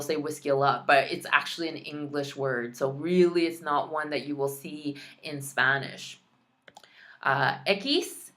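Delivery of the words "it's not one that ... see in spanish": normal talking voice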